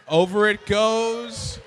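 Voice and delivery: excited countdown commentary; play-by-play commentary. Acted. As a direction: call the final seconds.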